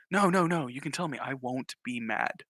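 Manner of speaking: soft, slowing reassurance; soothing parent. Acted. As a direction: coax a child gently into confessing. Acts dad coaxing Billy to tell.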